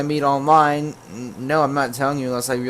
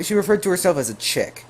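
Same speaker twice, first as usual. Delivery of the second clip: ordinary talk, nothing marked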